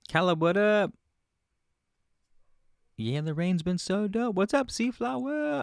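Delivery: high, loud greeting called out; calling out. Real, not acted. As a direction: call out to a friend across the street. Greeting someone outside, calling.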